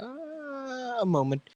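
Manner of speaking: a squeaky, high 'uh' that stalls for time; high stalling voice. Acted. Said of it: "I'll be like".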